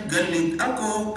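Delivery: slow, sing-song Ilonggo lilt on "Galit ako"; smooth Ilonggo lilt. Retold or imitated. Pastor retelling an Ilonggo sister's words, sing-song Ilonggo lilt. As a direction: let "Galit ako" float in a gentle Ilonggo tune. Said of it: "Very smooth".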